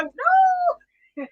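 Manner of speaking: imitating a friend's loud, high "no"; hammed-up high-pitched objection; mock protest. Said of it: "If B was here, she'd go".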